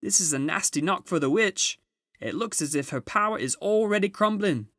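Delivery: high, loud joyful calling; a beaver shouting the good news; excited shout. A narrator's reading. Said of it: "almost dancing with delight".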